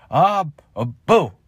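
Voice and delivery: high startled boo; startling boo. Acted. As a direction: shout boo to scare. Acting scared by prankster.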